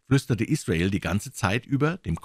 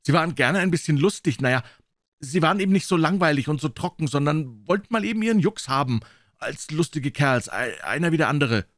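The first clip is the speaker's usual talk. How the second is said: higher, whiny sailor voice; a higher rough shipmate; Israel's voice; 7 semitones higher than usual. Acted. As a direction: play the grumbling mate, pitched up. Israel defending old crew.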